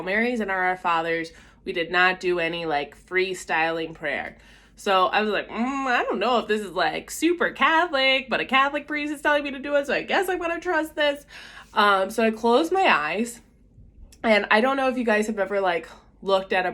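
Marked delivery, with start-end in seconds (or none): 5.5-11.2 rising, higher-pitched mock wavering; retold hesitant doubt. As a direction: talk yourself into it, climbing higher. Retold doubts about the priest's request.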